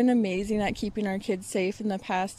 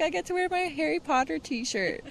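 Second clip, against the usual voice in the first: higher-pitched imitation of an excited kid; excited child quote; 8 semitones higher than usual. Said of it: "He was excited about".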